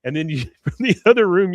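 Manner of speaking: usual speaking voice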